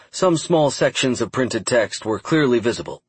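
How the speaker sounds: plain reading voice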